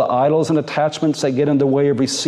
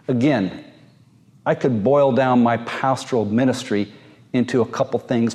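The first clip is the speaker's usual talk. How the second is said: normal talking voice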